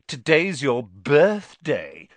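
bullying singsong sneer. A narrator's reading. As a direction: drag it out with mocking relish. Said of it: "sneered Dudley".

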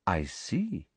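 quiet, sugary murmur; soft and knowing; softly. Acted. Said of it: "said Professor Umbridge softly".

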